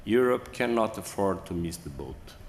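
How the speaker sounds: ordinary delivery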